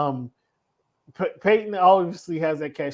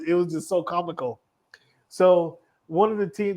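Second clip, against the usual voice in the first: ordinary delivery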